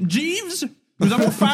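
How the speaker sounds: imperious call. Acted. Calling servant imperiously.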